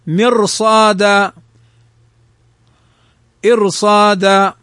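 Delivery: slow مرصاد and إرصاد, thick r, long vowel; heavy ra. Shown as a demonstration. Teacher models مرصاد and إرصاد, slow heavy ra. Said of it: "فإنها تفخم كذلك".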